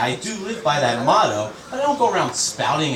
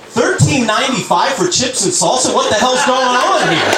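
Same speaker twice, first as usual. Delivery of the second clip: high, raised-voice indignation, hammed up; mock outrage; 8 semitones higher, 4 dB louder than usual. Tenth person in Telephone garbling the message, mock outrage. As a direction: play an outraged customer, pitch jumping up.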